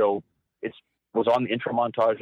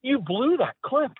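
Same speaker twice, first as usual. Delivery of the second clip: much higher, slow, scornful verdict; scolding Jim; 12 semitones higher than usual. Retold or imitated. Boss telling his cameraman the clip sucked, slow and high. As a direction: drag out the scolding. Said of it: "He's like".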